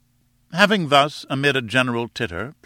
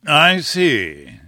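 louder, drawn-out dismissal; shrugging; 3 semitones higher, 6 dB louder than usual. A narrator's reading. Lecturer spotting his heckler, shrugging it off. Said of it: "with a shrug".

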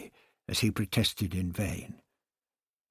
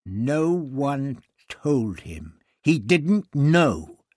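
very slow, much louder, each word pressed; indignant; 5 semitones higher, 9 dB louder, much slower than usual. A narrator's reading. Barbara cutting off the priest to defend the doctor, indignant and slow. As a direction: insist with slow, rising force.

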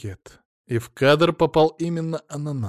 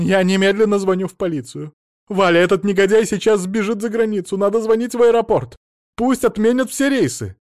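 high, loud, urgent tirade; rally everyone against the fugitive; frantic alarm; 8 semitones higher, 5 dB louder than usual. A narrator's reading. Mother-in-law vows to call police and airport, frantic shouting.

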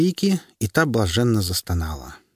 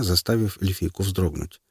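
ordinary delivery

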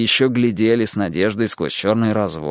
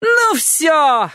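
put-on very high raging old lady; ranting neighbour; 4 dB louder than usual. A narrator's reading. Neighbour rages at the children, very high voice. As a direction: rant it out. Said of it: "разорялась миссис Фонтейн".

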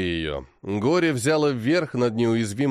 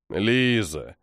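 heavy; 4 semitones lower than usual. A narrator's reading. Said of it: "тяжко роняет отец".

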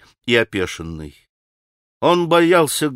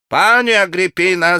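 put-on higher, pompous Polish-accented call; shouting; 5 semitones higher, 4 dB louder than usual. A narrator's reading. Pan shouts to Grushenka in offended pride. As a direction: shout her name with offended grandeur. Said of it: "закричал пан".

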